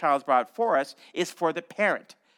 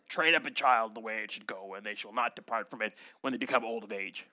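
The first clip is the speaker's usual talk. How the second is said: lower, softer, grand scripture voice; solemn deep reading; 8 semitones lower, 7 dB quieter than usual. Shown as a demonstration. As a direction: intone it like James Earl Jones. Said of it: "You have to say it like that".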